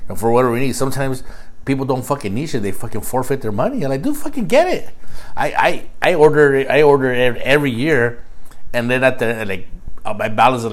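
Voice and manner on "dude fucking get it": excited. Retold or imitated. Retold urging coworkers.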